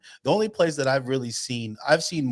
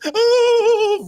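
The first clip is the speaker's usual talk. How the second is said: high, loud mock gasp; hammed-up shocked cry; mock dismayed oh; 9 dB louder than usual. Woman runs off camera.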